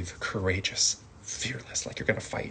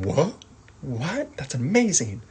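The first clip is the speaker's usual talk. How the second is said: mock astonished; 4 semitones higher than usual. Shown as a demonstration. Acting out astonishment.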